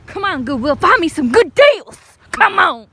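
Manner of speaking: loud excited cheering; excited shouting. Real, not acted. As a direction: shout it out like a hype chant.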